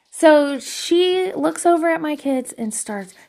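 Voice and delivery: ordinary delivery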